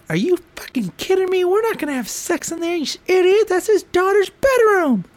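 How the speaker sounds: high mock wife voice. Wife scolding drunk Corey, high mocking voice. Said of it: "Ange goes".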